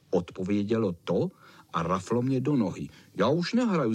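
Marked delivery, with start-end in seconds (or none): 3.1-3.9 mildly cross, pitched up; a touch of annoyed refusal. Acted. Cvoček quits the flea's game, mildly cross. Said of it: "zlobil jsem se".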